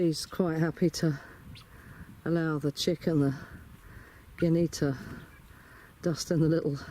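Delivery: normal talking voice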